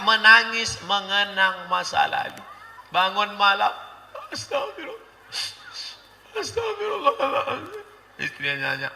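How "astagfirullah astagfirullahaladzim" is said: high, hushed, hammed-up weeping prayer; a squeaky, soft mock sob of repentance; mock tearful repentance. Husband at night, mock weeping astagfirullah.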